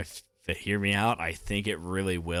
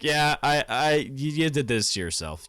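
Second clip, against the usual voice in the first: mock scolding; 6 semitones higher, 4 dB louder than usual. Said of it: "But he's like".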